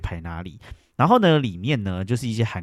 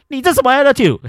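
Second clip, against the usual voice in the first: 你这什么attitude said high and loud, outraged; indignant outburst; 9 dB louder than usual. Retold or imitated. Reacting to the card being thrown, loud high outrage. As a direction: snap it at her after she throws the card. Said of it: "我就说".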